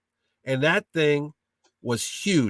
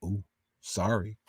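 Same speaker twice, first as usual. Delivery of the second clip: soft, slow, lower mock sorry; a sheepish little ooh, softer and slow; sheepish apology; 5 semitones lower, 9 dB quieter than usual. Husband imitates the wife seeing the eBay price, a soft, slow sheepish sorry.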